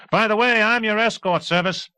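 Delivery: jaunty talkative flyboy; high, loud brash pilot; cheerful pilot. A narrator's reading. Erickson voice continues.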